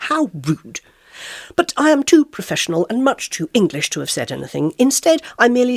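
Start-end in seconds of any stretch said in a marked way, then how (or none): none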